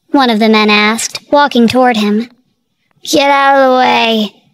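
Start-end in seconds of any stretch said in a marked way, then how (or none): 3.0-4.4 put-on slow slurred drunk snarl; drunken angry slur. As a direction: push past the men while drunk. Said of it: "Brady slurred angrily".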